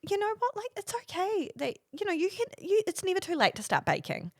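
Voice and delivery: sugary, consoling teacher voice; imitated sweet teacher. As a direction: reassure as if talking to a child. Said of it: "she said to me".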